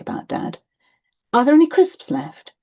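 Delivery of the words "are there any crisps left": put-on loud, high-pitched kid's question; child angling for a snack; asking child. A narrator's reading. Child asks mum for snack.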